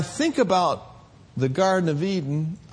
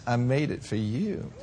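soft, quiet southern country twang with a laugh; a quiet, shy Southern-girl twang; 5 semitones lower, 7 dB quieter than usual. Retold or imitated. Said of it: "with that little cute little country southern twang".

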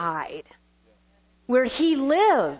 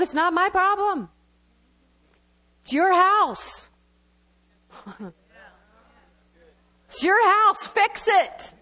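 higher-pitched, emphatic refusal; blunt mock reply; 5 semitones higher, slower than usual. God answering the complainer about their house, blunt mock reply. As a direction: hand the problem straight back, playfully blunt.

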